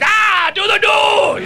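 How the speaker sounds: high, slow mock shout, a poor dictator voice; a deliberately terrible dictator yell; bad Hitler impression. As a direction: ham up a ranting dictator badly. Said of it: "That's not a great impression".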